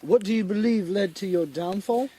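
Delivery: usual speaking voice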